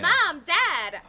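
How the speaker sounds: high, loud call; loud narrated cry. A narrator's reading. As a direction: cry out eagerly to your parents. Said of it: "I cried".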